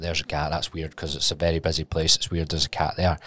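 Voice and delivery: murmured low to himself in a hushed gallery; hushed inner thought. Retold or imitated. Remembering the quiet gallery alley, hushed murmur. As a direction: keep it hushed, as if in a silent art alley. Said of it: "And I was like".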